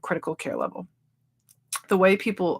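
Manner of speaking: ordinary talk, nothing marked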